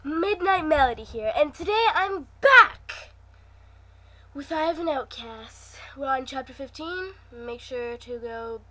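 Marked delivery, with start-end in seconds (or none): 0.0-5.8 bright, loud channel greeting; excited intro. Real, not acted. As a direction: greet viewers with big energy.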